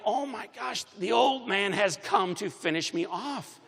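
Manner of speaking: high-pitched dramatized dread; mock panic. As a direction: pitch it high like a kid fearing doom, playfully. Retold panicked thought of his father.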